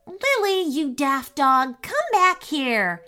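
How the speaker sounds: high, exasperated call after a runaway dog; high elf voice calling, slow; shouting after the dog. Acted. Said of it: "shouted Quinn".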